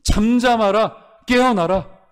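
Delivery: high, loud and very slow command; commanding proclamation. Shown as a demonstration. Pastor proclaims Jesus' command to the storm, high and loud. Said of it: "이렇게 하실 수 있는 유일한 분입니다".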